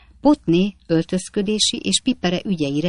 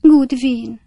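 usual speaking voice

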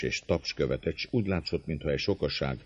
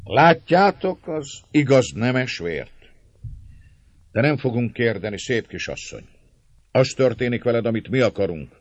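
raised, taunting threat; mocking Márton; 8 semitones higher, 8 dB louder than usual. A narrator's reading. Márton warning the lady about her coming husband, mocking threat. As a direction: open loud, then needle her with menace.

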